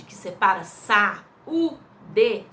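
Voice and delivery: "sa-u-d" said slowly in separate beats; syllables split. Shown as a demonstration. Teacher splitting saúde slowly for the class. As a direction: tap out "sa-u-d" one syllable at a time. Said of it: "A palavra saúde".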